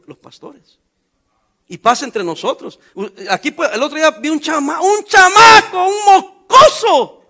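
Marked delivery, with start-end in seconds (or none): 4.8-7.2 high, loud and drawn out in scorn; a sneering yell at the spoiled kid; mocking shout. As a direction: stretch each word out in loud mockery. Mocking the young man with car.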